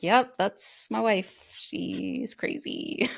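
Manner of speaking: slow, dry voice of a husband letting it go; lightly voiced husband shrugging off his wife; mock resigned husband. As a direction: drawl it out like a long-suffering husband. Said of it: "He's like".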